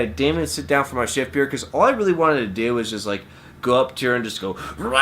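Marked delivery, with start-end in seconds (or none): 4.8-5.0 frustrated scream. Acted. Storyteller acts out wanting to scream at her, scream. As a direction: scream it out in someone's face. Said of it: "I just wanted to scream in her face".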